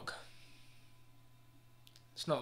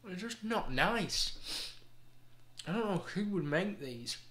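disgusted; 6 semitones higher than usual. Real, not acted. Disgusted after tasting bean.

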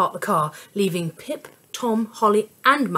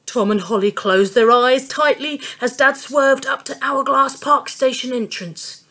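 plain reading voice

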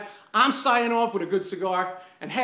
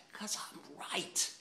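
whispered aside, slow; smug whisper; 3 semitones lower, 15 dB quieter, slower than usual. Real, not acted. Rant host whispers a smug aside after winning. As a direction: whisper it to yourself, pleased.

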